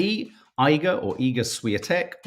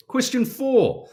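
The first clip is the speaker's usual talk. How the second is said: announcement; 10 semitones higher, 3 dB louder, slower than usual. Acted. Announcing next question.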